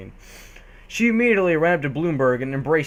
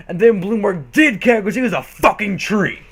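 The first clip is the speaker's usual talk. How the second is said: emphatic punchline; 5 semitones higher, 5 dB louder than usual. A narrator's reading. Punchline climax.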